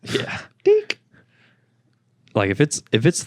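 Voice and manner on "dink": very high, loud "Dink" like a scanner; checkout beep. Retold or imitated. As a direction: chirp it like a register beep. Mimicking a checkout sound.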